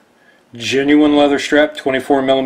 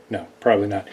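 usual speaking voice

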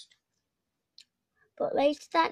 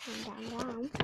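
fading down; 3 semitones lower, 12 dB quieter than usual. A narrator's reading. Jack sliding down the beanstalk.

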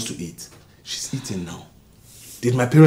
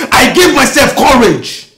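much higher, loud triumphant cry; proud shout; 12 semitones higher, 12 dB louder than usual. Acted. Husband to wife about his success, proud shout. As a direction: proclaim it.

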